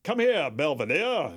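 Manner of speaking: southern drawl. Retold or imitated. Continuing the Foghorn Leghorn bit.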